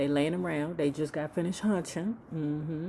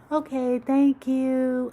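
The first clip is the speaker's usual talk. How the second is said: high, loud mock-sweet girlfriend voice; sugary high friend voice; friend asking sweetly; 8 semitones higher, 7 dB louder, slower than usual. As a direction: put on a high chirpy girlfriend asking permission. Friend voice again.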